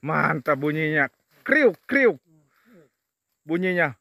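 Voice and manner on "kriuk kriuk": high, loud imitated crunching noise; crunch imitation. Vlogger shows how the fern snaps, loud crunch imitation. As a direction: mimic the snap of fresh fern.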